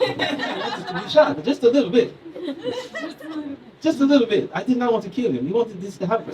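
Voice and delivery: high-pitched mock excuse; protesting Israeli guy. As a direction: play down the blame as an indignant guy. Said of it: "A guy from Israel".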